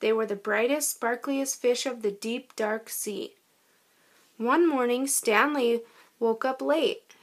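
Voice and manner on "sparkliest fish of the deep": plain reading voice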